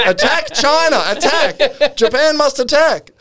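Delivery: very high-pitched mock war cry; Margaret Thatcher. Channeled Thatcher urging Japan to war, mock commanding. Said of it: "Margaret Thatcher starts advising".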